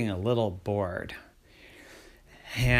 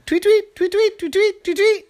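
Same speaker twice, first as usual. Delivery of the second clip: piercingly high bird calls; loud tweeting; 6 dB louder than usual. Acted. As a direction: chirp as loud as you can. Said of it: "as loud as they could".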